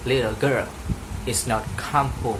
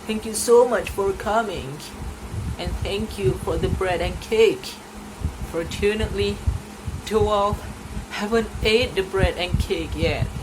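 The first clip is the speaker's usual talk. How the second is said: higher, warm granny voice thanking her; kindly grandmother; 7 semitones higher than usual. Acted. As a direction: grandmother seeing her daughter off.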